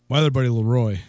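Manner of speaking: ordinary delivery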